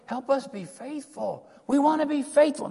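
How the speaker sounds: high, pleading plea for help; pleading cry. Retold or imitated. Church crying out to God, pleading. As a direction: cry out to be made faithful. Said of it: "The cry is".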